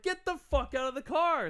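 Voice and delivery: high-pitched retold command; mock angry order. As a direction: imitate a stranger ordering someone out. Acted stranger ordering him out.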